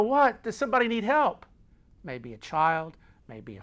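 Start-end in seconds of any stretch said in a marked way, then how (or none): 0.0-1.5 high, loud startled question; alarmed, raised-voice query; startled reaction. Acted. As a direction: react to a sudden cry. Acts startled reply to the yell.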